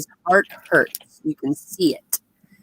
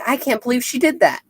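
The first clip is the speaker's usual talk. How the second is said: high, quick mock disbelief; retold disbelief; 9 semitones higher, faster than usual. [name] reacts as she walks away, mock disbelief. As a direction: imitate someone incredulous, pitched up and fast.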